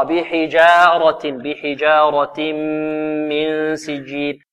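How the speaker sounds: بحجارة with full open fatha on jeem; tajweed demonstration; slow careful recitation. Shown as a demonstration. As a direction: draw out بحجارة without tilting the vowel. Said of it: "الجيم هنا مرقة والراء مفخمة".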